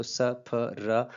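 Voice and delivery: "स", "फ", "र" each voiced alone, slowly; sounded-out consonants. Shown as a demonstration. Teacher showing three consonants in spray, "स", "फ", "र" spoken separately. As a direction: break the cluster into "स", "फ", "र", one at a time. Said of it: "तीन consonants आ रहे हैं".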